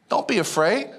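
ordinary delivery

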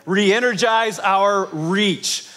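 slow, measured call to repeat; leading in unison. Shown as a demonstration. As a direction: stretch each word so a crowd can join. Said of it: "Can you say that with me?".

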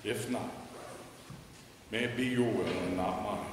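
usual speaking voice

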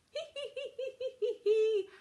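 high tee-hee Santa laugh; squeaky storybook chuckle; acted giggle. A narrator's reading. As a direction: giggle in a run of high hees. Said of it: "laughed Santa".

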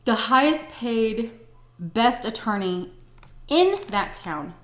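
slow, loud, each phrase punched; slow emphatic. Real, not acted. Telling viewers about the husband's lawyer, slow and emphatic. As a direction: lean on every word. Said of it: "stressing attorney's status".

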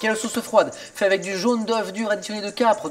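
ordinary talk

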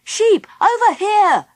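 high, loud calls across a distance; urgent hailing cry, pitched high; shouting. A narrator's reading. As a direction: shout to catch their attention. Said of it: "shouted Philip and Baby Bee".

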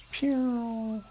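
high, soft "pew"; soft high sound effect; imitated whoosh. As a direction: make a little flying-lure noise. Imitates the cast flying out.

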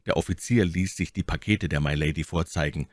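ordinary delivery

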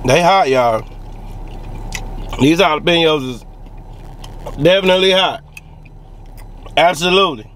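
speaking between bites; chewing while talking. Real, not acted. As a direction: mouth full of food. Seen 0: Grey-shirt man puts food in mouth, chews while speaking throughout.

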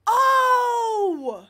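loud, high drawn-out "oh"; anguished wail. Real, not acted. Streamer cries out over a blunder, anguished wail. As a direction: wail it out loud, falling at the end. Wails at own mistake.

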